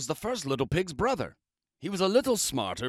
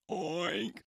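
pig oink; 4 semitones higher, 8 dB quieter than usual. Acted. Pig shout.

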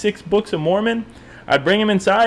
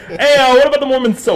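higher, louder mock phone question; pretend callback question; 8 semitones higher, 6 dB louder, faster than usual. Friend pretending to phone the missionary back, mock eager question. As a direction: call it out jokingly as if back on the line.

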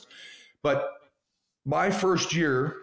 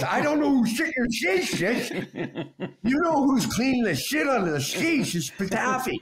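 high, odd-voiced trainer imitation; pitched-up trainer voice played for laughs; Patafi impression; 8 semitones higher than usual. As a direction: mimic the trainer's strange way of talking. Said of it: "he sort of sounded like this, eh?".

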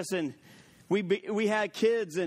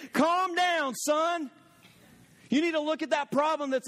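commanding shout; 8 semitones higher than usual. Shown as a demonstration. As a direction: bark the order at the problem like a rebuke. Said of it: "We need to say".

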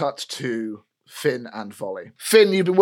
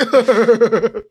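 smug laugh; 8 semitones higher, 7 dB louder than usual. Acted.

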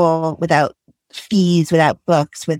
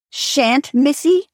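blazing; 8 semitones higher, slower than usual. Acted. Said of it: "blazed Missus Comstock".

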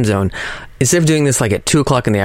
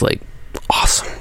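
awed whisper; 5 dB quieter than usual. Real, not acted. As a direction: breathe it in quiet wonder.

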